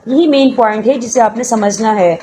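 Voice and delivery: normal talking voice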